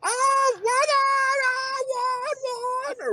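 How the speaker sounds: high, wailing cries; mock panicked wife. Wife crying out as her husband is swept away, high wailing. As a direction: wail his name like a frightened wife. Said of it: "I guess his wife going".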